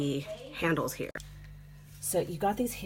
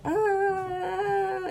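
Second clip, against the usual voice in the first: imitated baby wail.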